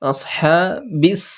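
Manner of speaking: very slow, stretched Arabic syllables; drawn-out recitation. Shown as a demonstration. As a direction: stretch the হা in আসহাবিস. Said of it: "সাকিনের কাজ করে হায়ে টান". (আসহাবিস ধীরে টেনে পড়া)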